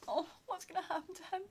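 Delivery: high, soft, near-tearful worry; mock-distressed quiet cry; worried whimper. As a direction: fret for the little boy, voice cracking. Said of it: "I was like".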